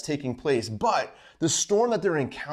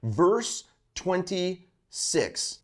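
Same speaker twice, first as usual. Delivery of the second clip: ordinary delivery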